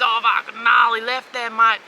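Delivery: lightly voiced Aussie surfer slang; a hint of an Aussie surfer bloke. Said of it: "they were all talking surfy terms".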